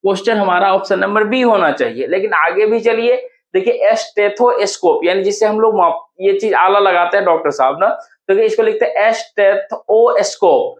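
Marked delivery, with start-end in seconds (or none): 3.9-5.1 "stethoscope" very slowly, in separate parts; syllable by syllable. Shown as a demonstration. Teacher breaking "stethoscope" up for students, syllable by syllable. 9.1-10.7 "stethoscope" slowly as steth-o-scope; split into parts. Shown as a demonstration. Teacher showing students three parts of a word, syllable by syllable. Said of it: "तीन part में तोड़ दिए".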